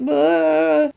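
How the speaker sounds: high-pitched mock wail; mock panicked cry. Retelling a scared group's cry, mock panic.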